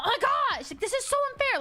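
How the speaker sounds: high, whiny protest of unfairness; hammed-up indignant wail; mock outrage. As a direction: complain dramatically at the cards.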